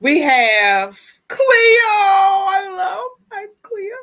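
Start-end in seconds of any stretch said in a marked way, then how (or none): 1.3-4.0 delighted shout-out; excited shout. Real, not acted. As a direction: gush over a favourite. Greets a favourite viewer.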